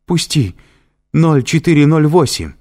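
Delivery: high desperate shout; desperate scream. A narrator's reading. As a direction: scream out the code in terror. Триш в ужасе кричит. (отчаянный крик)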